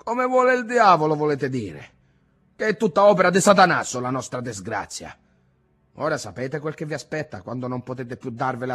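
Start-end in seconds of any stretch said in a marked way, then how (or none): 0.1-5.2 loud, high sarcastic jab; scornful outburst. A narrator's reading. Ntoni scoffing at his grandfather's faith, loud and sarcastic. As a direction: spit the devil back at him.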